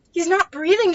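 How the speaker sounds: put-on high, loud cry of panic over a drowned child; panicked scream. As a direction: shriek it in terror. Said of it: "she screamed".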